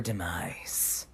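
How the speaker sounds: low, dark, dropping to a hush; ominous. Acted. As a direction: say it like a villain sealing fate. Dramatic revenge build-up.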